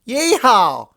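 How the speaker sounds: high, loud whoop of delight; excited yeehaw. A narrator's reading. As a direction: let out a joyful cowgirl holler. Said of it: "excited exclamation".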